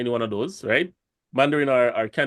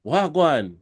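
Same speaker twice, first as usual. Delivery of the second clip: mock Jamaican greeting; 5 semitones higher than usual. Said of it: "they find out that you're Jamaican".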